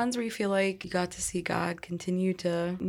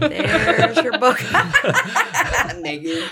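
laughing; 6 semitones higher, 7 dB louder than usual. Real, not acted. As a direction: crack up while saying it. Everyone laughing over book idea.

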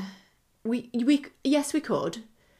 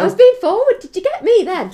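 loud, high, giggly exclamation; cheeky protest; 12 semitones higher, 12 dB louder than usual. Real, not acted. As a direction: squeal it out, tickled by your own cheek. Laughing about own cheeky offer.

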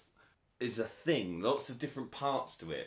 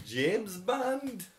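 higher-pitched mock Scouse; terrible Liverpool accent; 6 semitones higher, much slower than usual. Teasing the co-host's Scouse Bond, terrible accent. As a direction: mimic a bad Scouse accent. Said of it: "That was a really terrible accent".

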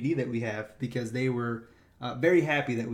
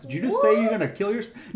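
higher, louder alarmed question; 8 semitones higher, 5 dB louder than usual. Retold or imitated. Ranger reacting to the threat, alarmed and louder. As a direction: snap back in shocked disbelief.

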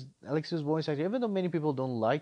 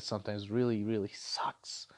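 whisper; 6 semitones lower, 7 dB quieter than usual. Real, not acted. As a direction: drop to a whisper for the punchline. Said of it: "whispered aside".

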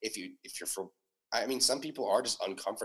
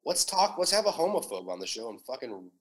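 normal talking voice

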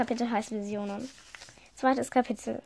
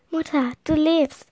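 raised, louder cry to mother; joyful exclamation; 5 semitones higher, 6 dB louder than usual. A narrator's reading. Tochter sieht tote Mutter lebend. (freudiger Ausruf)

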